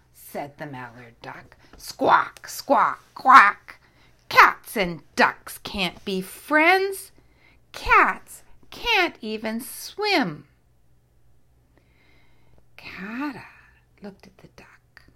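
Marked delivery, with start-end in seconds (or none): 1.8-3.8 loud squawking duck calls; duck squawks. Acted. Mallard duck squawking at the cat. 4.3-10.5 loud, slow duck voice laying down the law; bossy duck. Acted. Mallard duck telling the cat off, loud and slow.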